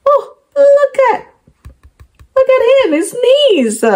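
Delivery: high, delighted pointing out; excited look. Real, not acted. To the child, pointing at him, excited. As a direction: call their eyes to it with delight.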